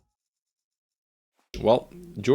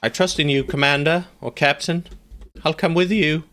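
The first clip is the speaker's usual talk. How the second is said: higher, eager soldier's voice; a lightly voiced loyal follower pledging himself; mock-earnest soldier; voicing a loyal soldier; 8 semitones higher than usual. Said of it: "But Juicy Crab says".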